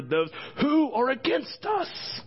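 high, drawn-out emphatic finish; preaching climax. Real, not acted. Pastor assuring congregation who is outnumbered, climactic preaching. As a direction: hit the last words hard and slow.

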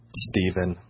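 soft, low single name; Ella's quiet interjection. Retold or imitated. Woman interrupts a stressed colleague's rambling, quiet and low. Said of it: "Just like that".

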